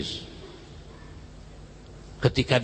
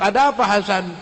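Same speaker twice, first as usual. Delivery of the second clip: high, loud puzzled call through the door; teacher calling out in surprise; a startled teacher knocking and calling; 12 semitones higher, 5 dB louder than usual. Acted.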